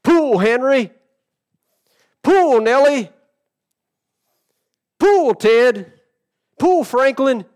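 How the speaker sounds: high loud farmer's yell, calling each name. Retold or imitated. Farmer snapping the reins, yelling at his mule. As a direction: shout the names out across the field. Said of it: "and yelled out".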